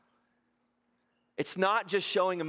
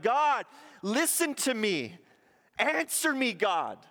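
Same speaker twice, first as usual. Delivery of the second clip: pleading prayer; 8 semitones higher than usual. Retold or imitated. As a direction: beg God to listen, with urgency. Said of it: "I'm like".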